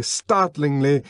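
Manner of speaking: ordinary delivery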